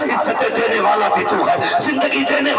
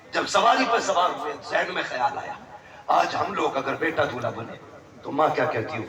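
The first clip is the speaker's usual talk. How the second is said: ordinary talk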